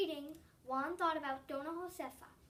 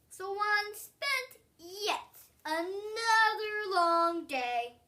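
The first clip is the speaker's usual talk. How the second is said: slow, louder, sing-song stretch; drawn-out narration; 5 semitones higher, 8 dB louder, much slower than usual. A narrator's reading. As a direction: drag the words like the long day. Narrator stretching a weary phrase.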